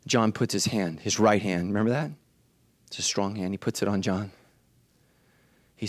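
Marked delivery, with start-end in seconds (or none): none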